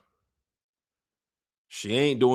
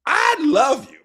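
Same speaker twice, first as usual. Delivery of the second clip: shouted, much higher "I love you"; loud declaration; 14 semitones higher, 7 dB louder, slower than usual. Retold or imitated. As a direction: belt it out over-the-top. Ulf declares love to queen.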